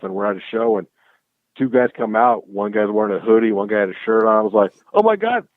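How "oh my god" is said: excited surprise. Retold or imitated. Seeing bands wear his merch.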